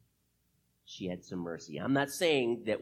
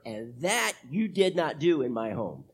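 ordinary talk

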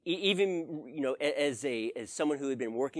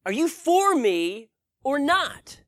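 high, loud pleading lament; a man crying out to God in frustration; desperate complaint to God; 10 semitones higher, 8 dB louder than usual. Retold or imitated. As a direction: pour out your grievance, voice strained and raised. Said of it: "I just began to pour out my complaints to God".

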